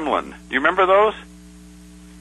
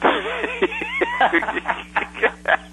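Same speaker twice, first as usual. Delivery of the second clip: high laugh; 4 dB quieter than usual. Real, not acted. Laughs at the beat-up joke.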